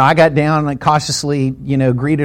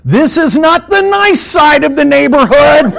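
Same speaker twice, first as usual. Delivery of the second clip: high, drawn-out loud shout; re-enacted full-voiced holler; outraged yell; 10 semitones higher, 6 dB louder than usual. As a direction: yell it down the street. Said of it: "I actually yelled out loud".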